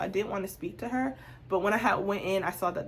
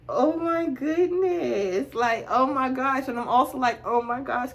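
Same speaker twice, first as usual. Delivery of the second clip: overwhelmed by the compliment; flustered surprise; 5 semitones higher, 7 dB louder than usual. Retold or imitated. Flattered by a clerk's compliment.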